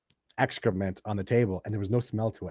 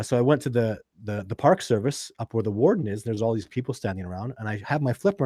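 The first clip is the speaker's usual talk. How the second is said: ordinary delivery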